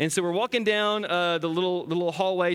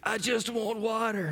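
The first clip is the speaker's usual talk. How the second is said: put-on softer, slow, parched little girl; dry raspy voice; 4 semitones higher, 4 dB quieter, slower than usual. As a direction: croak it out weakly, too sick for a treat. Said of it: "dry, raspy, like she's been wandering in the desert".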